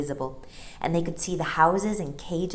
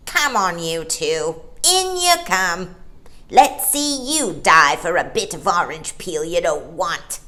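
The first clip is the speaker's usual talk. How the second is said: louder, bitter taunting; bitter angry seal; 7 dB louder than usual. Acted. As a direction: sneer at the children. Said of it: "a bitter, angry voice".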